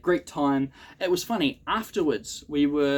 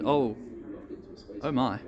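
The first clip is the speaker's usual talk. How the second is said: ordinary talk, nothing marked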